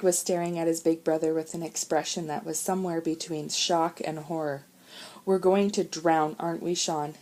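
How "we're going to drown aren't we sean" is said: ordinary delivery, nothing marked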